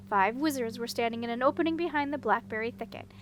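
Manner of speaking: plain reading voice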